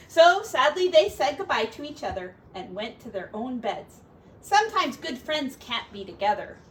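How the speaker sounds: gentle storybook narration; reading to children. Acted. Parent reading a picture book to a child, storybook voice. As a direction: read it aloud to a little one.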